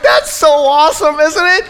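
high, loud gleeful crowing. Retold or imitated. Winner gloats to the opponent whose ribs he broke. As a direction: crow it out with a laugh, high and delighted.

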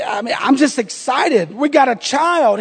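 ordinary delivery

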